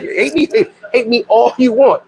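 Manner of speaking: ordinary delivery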